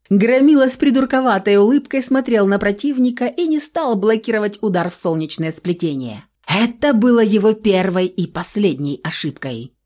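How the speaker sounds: plain reading voice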